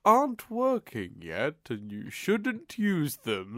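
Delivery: drawn-out imitation, words stretched wide; slow speaking. Mimicking the slow talker finishing her warning too late, drawn out. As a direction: trail far behind, very slowly. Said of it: "it's the slow speaking of".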